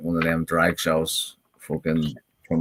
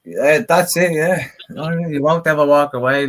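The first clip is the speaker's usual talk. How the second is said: excited; 9 semitones higher, 7 dB louder than usual. Real, not acted. Excited praise of a friend.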